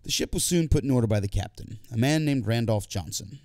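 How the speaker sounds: plain reading voice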